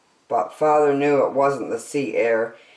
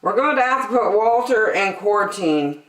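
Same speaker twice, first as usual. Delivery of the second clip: higher official voice; Officer Smedley; 6 semitones higher, 4 dB louder than usual. A narrator's reading. Smedley announces quarantine.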